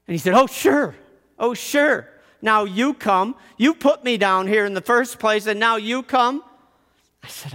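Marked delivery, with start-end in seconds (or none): 0.3-6.4 higher, louder, resentful jabs; bitter reproach. Retold or imitated. Locked-away Bart resents his jailer, bitter and raised. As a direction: throw his neglect back at him. Bitter Shame confronts the narrator.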